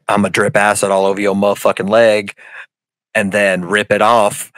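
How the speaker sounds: low, menacing monster voice; monster threat. Retold or imitated. The fly man threatens the boyfriend, low and menacing. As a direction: growl it like a mutant fly. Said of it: "Brundlefly's like".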